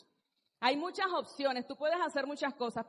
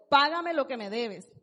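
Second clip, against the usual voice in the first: louder, harsh demand; demanding servant; 8 dB louder than usual. Acted. Servant choking his fellow servant, demanding payment loudly. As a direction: throw out Págame lo que me debes as a hard demand. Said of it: "le ahogaba diciendo".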